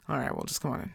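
low, soft, laid-back imitation; real chill. Doctor inviting a worried patient in, real chill. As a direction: casually play it down, low and soft. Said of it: "Just, like, real chill".

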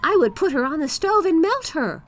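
put-on high, loud boyish boast; bold little boy. A narrator's reading. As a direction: brag like a fearless small boy. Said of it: "cried the boy".

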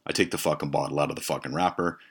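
ordinary talk

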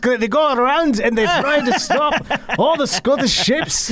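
high put-on Scottish accent; playful Scottish brogue. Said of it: "I didn't want to go with my pirate voice".

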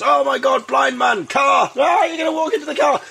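high, loud shriek of alarm; mock-hysterical passer-by yelling danger; panicked shout. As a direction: cry out a frantic, hammed-up warning.

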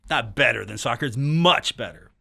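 normal talking voice

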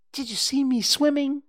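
bursting with pride, squeak it out; squeaked excitedly. A narrator's reading. Roo asks everyone if they saw him swim, high and excited. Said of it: "squeaked Roo excitedly".

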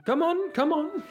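very high, sing-out call; jovial beckoning. Acted. Mayor looks back at the visitors, jovially beckoning. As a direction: call them along merrily, pitched right up. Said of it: "very jovially and looks back at you".

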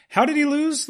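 retold high-pitched puzzlement; baffled disbelief. Moviegoer recalling confusion at the game's outcome, baffled. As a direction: throw the question up high, mock-incredulous. Retelling own baffled reaction.

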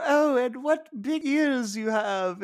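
high, slow falsetto. Retold or imitated. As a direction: draw it out as a hammed-up fairy-tale girl.

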